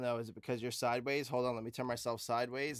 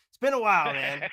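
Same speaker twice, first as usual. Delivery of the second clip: loud announcer voice; 4 semitones higher, 13 dB louder than usual. Acted. Podcast host loudly reopens the show.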